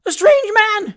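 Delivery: very high, loud cry of terror; lady's shriek. A narrator's reading. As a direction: shriek it in alarm. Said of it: "shrieked the lady".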